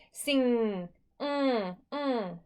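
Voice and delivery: "Sing" with the final ng held as a hum. Shown as a demonstration. Teacher shows the mm of sing, held-out ng. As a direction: hold the end of "Sing" on a hummed "mm". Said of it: "Say sing and hold out the mm".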